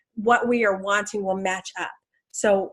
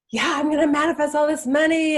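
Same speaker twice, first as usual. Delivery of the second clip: higher-pitched eager self-quote; mock enthusiasm; 6 semitones higher than usual. Speaker mimicking herself vowing to manifest money, mock enthusiasm. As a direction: playfully act out big confidence. Acts out her own boasting.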